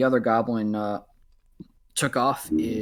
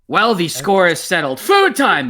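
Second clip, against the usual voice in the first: loud, much higher cheer; gleeful goblin; 6 semitones higher, 8 dB louder than usual. Acted. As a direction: shout it like dinner bell. Goblin announcing feast.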